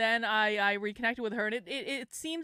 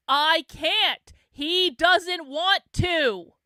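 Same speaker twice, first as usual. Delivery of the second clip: loud, raised, emphatic outburst; exasperated yelling; 4 semitones higher, 8 dB louder, much slower than usual. Real, not acted. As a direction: yell it at the pestering critics, word by word. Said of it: "Why are you yelling?".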